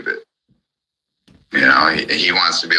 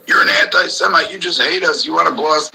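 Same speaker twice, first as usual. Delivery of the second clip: high-pitched mocking whine, much higher than his own voice; 12 semitones higher than usual. Ranting vlogger mimics people he argues with, high mocking whine. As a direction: parody the whiny complaint high up.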